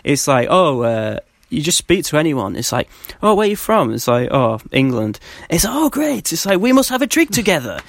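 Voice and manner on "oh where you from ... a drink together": high, cheery fan voices; friendly German fans. Retold or imitated. Fan recalling chat with friendly German supporters, higher cheery voices. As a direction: play the welcoming locals, bright and high.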